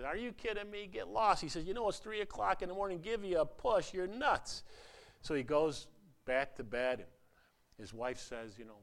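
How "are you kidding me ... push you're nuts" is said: annoyed husband. Retold or imitated. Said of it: "and Bobby says".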